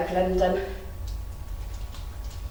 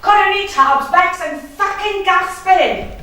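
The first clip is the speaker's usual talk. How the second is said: high, loud and eager; eager teen shout; 10 semitones higher, 5 dB louder than usual. Retold or imitated. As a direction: yell it down the stairs, desperate for a smoke. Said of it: "I'd go".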